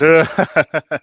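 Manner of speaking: short, loud mock giggle; imitated little giggle. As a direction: copy his little giggle. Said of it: "I did your little giggle at the end".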